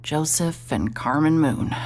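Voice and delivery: ordinary delivery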